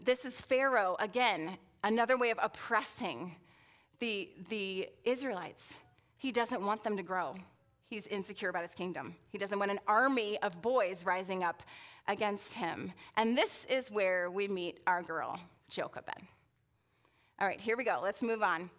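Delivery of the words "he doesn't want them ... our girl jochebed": ordinary talk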